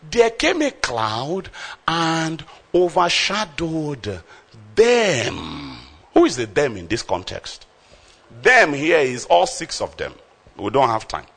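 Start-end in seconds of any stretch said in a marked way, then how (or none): 0.1-5.8 slow dramatic reading. Real, not acted. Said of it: "slow dramatic scripture reading".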